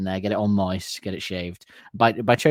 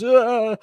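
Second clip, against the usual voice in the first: sudden high yelp, much higher and louder; cartoon yelp; 12 semitones higher, 5 dB louder than usual. Elmer Fudd startled by Bugs, high cartoon yelp. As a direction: squeal like a startled cartoon hunter. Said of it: "Elmer Fudd goes".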